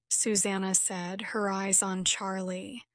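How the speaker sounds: ordinary delivery, nothing marked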